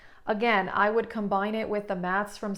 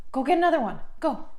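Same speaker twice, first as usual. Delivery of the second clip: high, louder pet coaxing; dog voice; 5 semitones higher, 4 dB louder, much faster than usual. Real, not acted. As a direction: urge the dog on, sing-song. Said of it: "I do use my dog voice in this video".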